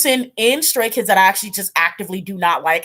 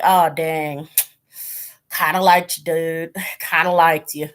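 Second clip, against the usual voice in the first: put-on slipping into a country accent, low; 4 semitones lower than usual. Disappointed fan talking to Han, in a country accent. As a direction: let a low country twang creep in. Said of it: "where that country accent came from".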